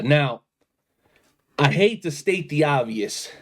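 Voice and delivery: ordinary delivery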